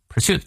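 "Pursuit" with the stress on "suit"; stress on second syllable. Shown as a demonstration. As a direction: lean on the end of "Pursuit". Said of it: "重音在后".

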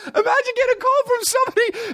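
very high, squeaky laughing voice; hammed-up hysterical disbelief; laughing incredulously. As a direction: talk through a falsetto laugh. Cracking up at the absurd news.

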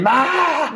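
high-pitched imitated celebration scream; demented scream. Friend demonstrating how they celebrated the goal, demented high scream. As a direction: let out a crazed fan's high yell. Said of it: "Gritábamos como dementes, cabrón, así".

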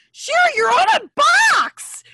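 high, loud squeal of delight; excited friend's shout. Retold or imitated. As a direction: shriek it like a thrilled friend. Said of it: "she was really excited".

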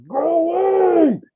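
high, loud, drawn-out mock scream; comically desperate high-pitched yelling; screaming from inside. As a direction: holler hysterically at a pest outside your house. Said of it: "that shit be screaming from the inside".

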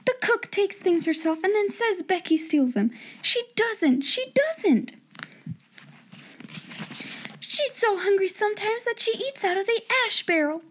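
high, passionate protest; indignant young girl. A narrator's reading. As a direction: defend her friend fiercely. Sarah defends Becky, upset.

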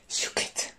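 breathless unvoiced gasp of shock; a sharp whispered gasp of surprise. Friend recalls her shock at news, whispered gasp. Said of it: "ahí sí que me quedé".

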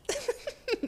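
high giggle; amused giggle at own joke; real laugh. Real, not acted. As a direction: burst out giggling.

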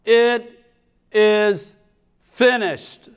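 high, drawn-out dramatic cry, word by word; yelled out. Acted. Jesus on the cross in a church play, yelling out. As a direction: call it out slowly with solemn drama. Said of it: "he yells out".

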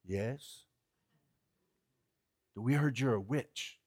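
very low, soft, guarded answer; a hushed cautious witch at the door. Retold or imitated. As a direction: whisper through the door, wary of strangers.

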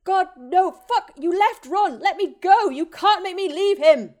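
very high, loud shrieking grief; anguished screaming. Acted. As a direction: scream as if your friend is left behind. Harry fighting to go back.

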